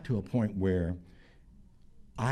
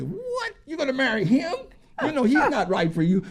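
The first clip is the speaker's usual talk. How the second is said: disapproving family; 14 semitones higher than usual. Retold or imitated. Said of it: "the family say".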